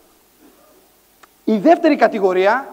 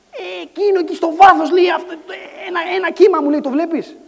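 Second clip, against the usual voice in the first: higher, urgent acted friend; insistent pointing out; 6 semitones higher than usual. Friend pointing at a distant wave, insistent.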